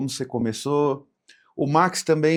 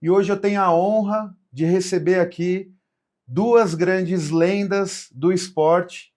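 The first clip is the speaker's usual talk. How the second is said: podcast host intro; 5 semitones higher, 3 dB louder, slower than usual. Acted. Podcast opening host read.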